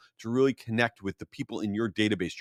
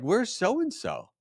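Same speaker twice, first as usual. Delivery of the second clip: baffled at the wedding; 6 semitones higher than usual. Retold or imitated. Groom at his wedding wondering where guests are, high and baffled. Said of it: "And I'm like".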